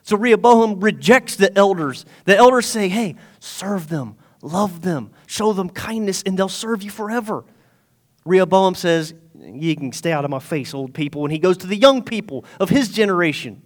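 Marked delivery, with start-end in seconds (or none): 2.9-7.4 gentle counsel. Retold or imitated. Elders advising the new king to serve his people, gentle counsel. 9.4-11.2 low, soft mock dismissal; dismissive brush-off. King rejecting the elders' advice, low dismissive aside.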